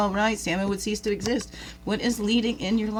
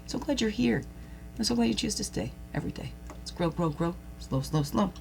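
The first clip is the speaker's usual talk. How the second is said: heartfelt closing blessing. Acted. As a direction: send them off with love.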